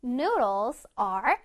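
very slow, every word drawn out; slow teacher model sentence; slow model reading. Shown as a demonstration. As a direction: read it out slowly for the kids to copy. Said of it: "One more time".

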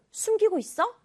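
high, suspicious probing question. Shown as a demonstration. Teacher showing suspicion, high probing tone. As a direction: ask like you smell a secret. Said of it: "뭔가 수상해, 의심이 돼".

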